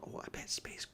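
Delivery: hushed, secret guess; whisper. Acted. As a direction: whisper it to the kids. Guessing aside before the reveal.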